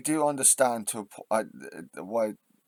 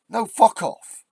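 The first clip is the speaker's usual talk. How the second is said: loud, high angry retort; furious no, fuck off; angry shout; 10 semitones higher, 9 dB louder, slower than usual. Real, not acted. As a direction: bark it back hard. Snapping back at calm advice.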